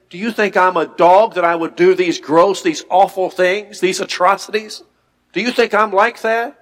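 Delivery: high, loud, offended disbelief; indignant protest. Acted. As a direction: act shocked at the accusation. Hazael protests the prophecy indignantly.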